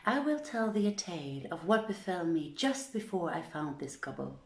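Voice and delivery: ordinary delivery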